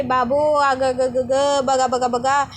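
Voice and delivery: put-on lament in a changed voice; mock wailing. Nanda making a show of crying to Kabir, mock wailing. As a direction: imitate a fake wail, only half-heartedly. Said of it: "रडण्याचं जोरजोरात नाटक करते".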